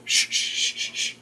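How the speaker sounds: string of soft voiceless shushes; urgent shushing. Acted. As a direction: hush a child with repeated shh sounds. Sprat shushes the other.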